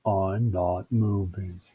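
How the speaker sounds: low, slow, monotone stubbornness; firm refusal. Acted. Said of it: "said Bill firmly".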